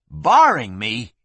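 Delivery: slow, loud outraged question. A narrator's reading. Gunman told he is barred, outraged. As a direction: drag it out in indignation. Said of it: "Flynn was outraged".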